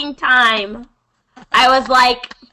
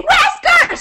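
high, urgent call to the cat; pitched-up frantic call. Retold or imitated. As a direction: yell the cat's name in alarm. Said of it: "I go".

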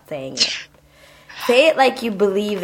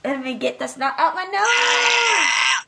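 high, slow, hammed-up chant; silly singsong; 9 semitones higher than usual. Host goofing around clearing her nose before recording, high and silly. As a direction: chant it like a goofy little tune.

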